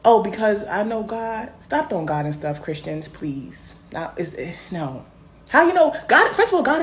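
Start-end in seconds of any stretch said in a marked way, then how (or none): 5.5-6.8 higher, louder challenge; indignant. Real, not acted. Challenging the Christians, higher and louder. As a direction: throw the question back hard.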